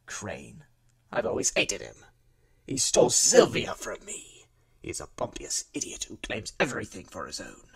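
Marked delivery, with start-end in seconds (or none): 1.1-7.6 theatrical, seething rant with loud bursts; overdramatic, hate-filled performance; worked-up hatred. Acted. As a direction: spit out the grudge, swinging between low seething and loud outbursts. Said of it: "Calm down, Mister Yugani. There's really no need to make a performance".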